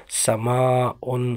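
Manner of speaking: سماعن stretched very slowly, nasal -an ending sounded clearly; slow recitation demo. Shown as a demonstration. Teacher drilling students on Quranic words, slow and clear. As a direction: draw out سماعن syllable by syllable. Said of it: "اس طرح سے ہے".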